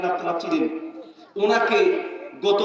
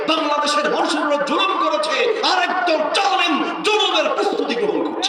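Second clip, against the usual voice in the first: building to a shout; 7 semitones higher than usual. Acted. Sermon climax listing oppressors.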